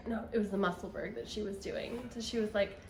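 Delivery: usual speaking voice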